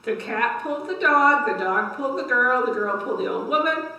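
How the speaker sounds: lively, rising read-aloud for little ones; story time voice for a class; reading to children. A narrator's reading. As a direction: build the effort with each pull. Reads cumulative tale to kids.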